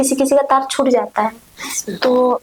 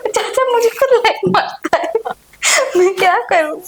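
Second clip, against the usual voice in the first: indignant high protest; 7 semitones higher than usual. Acted. Protesting uncle flirts with her.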